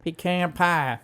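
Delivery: "Pecan" as pee-CAN, playfully put on; mock pee-CAN pie. Co-host echoing the funny pronunciation, mock pee-CAN pie. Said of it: "funny when people say pecan".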